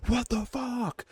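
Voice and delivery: very high, strained exclamation; imagined freak-out. Retold or imitated. Host imagines himself having that dream, high mock freak-out. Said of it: "Like, it would freak me out".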